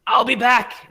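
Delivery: high, loud parting threat; storming-out yell. Acted. Angry woman storming out of the room, yelling a parting threat. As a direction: yell it back while storming off. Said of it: "storms out".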